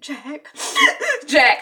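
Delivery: high, hyperventilating mock sobs; crying. Wife seeing her husband's body, crying and hyperventilating. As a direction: gasp the name through acted tears. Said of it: "crying and".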